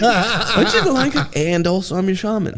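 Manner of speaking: very high-pitched, laughing riff; silly high voice, cracking up; laughing mock shaman. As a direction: squeal it out through laughter.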